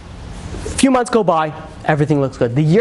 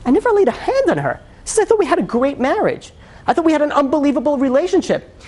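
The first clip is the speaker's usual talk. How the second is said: much higher, disbelieving protest of innocence; stunned denial; 9 semitones higher than usual. Retold or imitated. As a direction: plead like you can't believe your ears. Husband denies wife's abuse claim.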